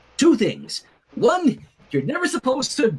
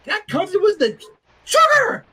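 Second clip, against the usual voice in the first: very high, louder shriek on sugar; hyper shriek; 12 semitones higher, 6 dB louder than usual. Acted. Reader mocking Rainbow's sugar rush with a high shriek. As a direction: shriek it like a sugar-crazed kid.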